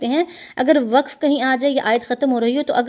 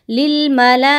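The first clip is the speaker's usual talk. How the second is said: Quranic recitation; 4 dB louder than usual. Shown as a demonstration. Teacher recites the verse for her students, chanted. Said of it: "recites verse to class".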